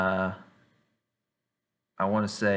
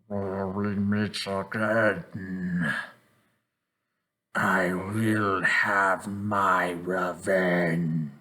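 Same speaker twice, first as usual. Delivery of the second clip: very slow, drawn-out villain menace; abnormal monster voice; much slower than usual. Acted. Monster speaks through the manacled victim, slow abnormal villain voice. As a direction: stretch every word like a possessing creature. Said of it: "you hear just this abnormal voice come out from his mouth".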